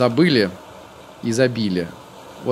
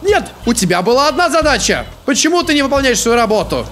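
angry yelling; 10 semitones higher than usual. Real, not acted. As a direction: shout at the useless scarecrow. Scarecrow failed again, yelling.

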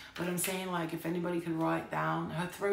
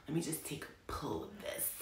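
whispering; 4 semitones lower, 8 dB quieter than usual. Real, not acted. Whispered aside while smoking.

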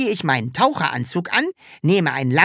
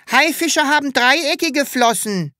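lightly voiced small boy, higher; 7 semitones higher than usual. A narrator's reading. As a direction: pipe up like a know-it-all little boy. (leicht angedeuteter kleiner Junge)

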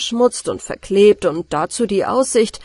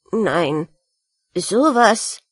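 astonished; much slower than usual. Acted. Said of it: "dachte die Ratte verblüfft".

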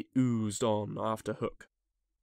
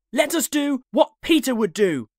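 high, loud, resolute shout; rally the gang in a boy's voice; boys' rallying cry; 12 semitones higher, 7 dB louder than usual. A narrator's reading. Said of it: "they cried".

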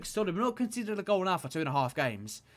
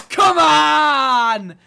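loud, high roar; celebration roar; 9 semitones higher, 14 dB louder than usual. Real, not acted. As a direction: roar it after scoring. Goal celebration.

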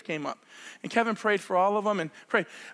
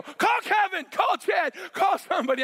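urgent shout; 9 semitones higher than usual. Retold or imitated. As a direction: shout out for help. In pain, urgent plea.

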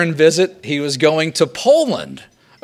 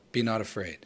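quiet; 4 semitones lower, 12 dB quieter, faster than usual. Shown as a demonstration. Repeats pope's homily words.

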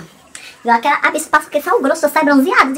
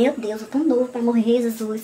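ordinary talk, nothing marked

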